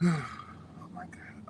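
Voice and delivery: sighing, spent whisper; breathy whisper. Real, not acted. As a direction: breathe it out exhausted. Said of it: "the anointing is lifting off of me".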